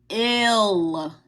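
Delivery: ordinary delivery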